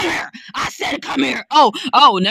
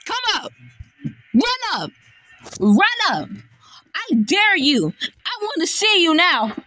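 taunting; 4 semitones higher than usual. Real, not acted. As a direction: taunt her to come closer. Taunting the enemy aggressively.

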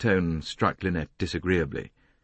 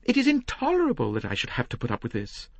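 very high, stammering protest; high, indignant woman's voice; exasperated lady. Acted. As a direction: protest in high-pitched outrage. Lynette protests to Poirot.

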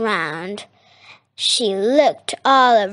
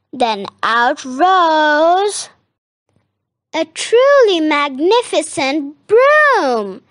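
grand reveal; 5 semitones higher, 4 dB louder than usual. A narrator's reading. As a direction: build up the big reveal, stretching it out. Dramatic reveal of magic broom.

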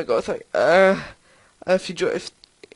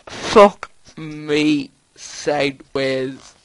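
low, very slow, drawn-out curse; stunned swearing; 7 semitones lower, much slower than usual. Real, not acted. Gamer defeated by the crash, stunned slow swearing. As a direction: stretch each word out in defeat.

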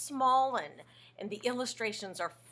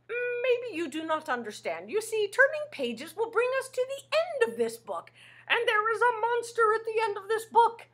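high, insistent monster explaining; Grover voice; 10 semitones higher, 4 dB louder than usual. Acted. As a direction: lecture the kids in Grover's voice. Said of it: "says Grover".

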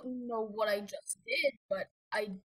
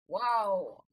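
normal talking voice

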